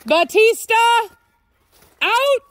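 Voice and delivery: high, loud call across the yard; shouting for the dog to come. Real, not acted. Calling dog from distance.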